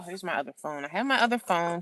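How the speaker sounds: normal talking voice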